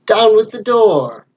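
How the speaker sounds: the blind man shouts to his crew to break down the door; villain's cry. Acted. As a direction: bellow the order to break in. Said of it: "the blind man's voice cry".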